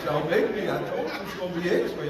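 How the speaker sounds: ordinary talk, nothing marked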